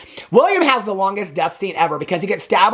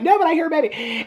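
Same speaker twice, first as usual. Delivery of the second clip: mock insistent protest; 9 semitones higher than usual. Character insists she hears a baby.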